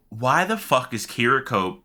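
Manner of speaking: ordinary delivery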